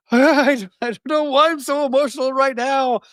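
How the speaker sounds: high, strained, tearful-sounding replay of a breakdown; retold breakdown. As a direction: relive the moment your voice cracked at therapy. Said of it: "And I just broke down".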